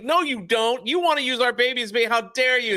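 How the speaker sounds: mother accusing her husband; outraged mom. Retold or imitated. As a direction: hurl it at your husband. Mom continues the argument.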